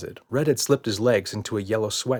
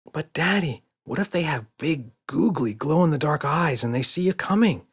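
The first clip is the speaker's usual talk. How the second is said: mild shout; 6 semitones higher than usual. A narrator's reading. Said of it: "shouted Emma".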